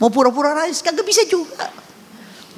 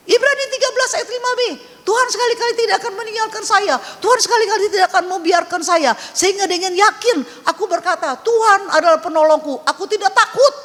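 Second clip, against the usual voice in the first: higher and fast, bursting with relief and laughter; joyful scripture recital; 9 semitones higher than usual. Real, not acted. Pastor to the congregation, reciting the verse in high, fast joy. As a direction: rattle off the verse in high, happy triumph.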